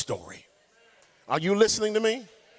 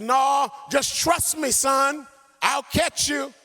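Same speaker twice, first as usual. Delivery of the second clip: urgent plea; 8 semitones higher, slower than usual. Retold or imitated. Father pleading with son.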